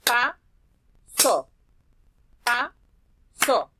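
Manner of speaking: "Pasó" split loud into PA-SÓ; clapped syllables. Shown as a demonstration. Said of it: "con la palabra pasó". ("Pasó" separado en sílabas con palmadas)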